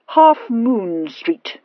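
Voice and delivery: slow, certain tipsy statement; positive. Acted. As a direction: lay it down very slowly, sure of yourself. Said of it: "he said positively".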